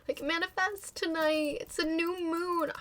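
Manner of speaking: mocking. Mocking New Year manifesting.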